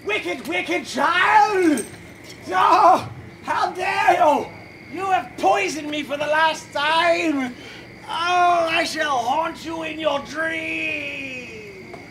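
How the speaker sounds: hammy dying villain cries; overacted death scene. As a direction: ham up a melodramatic death. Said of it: "Enough dramatic death scenes".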